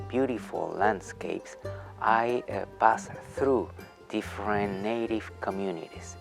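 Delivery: normal talking voice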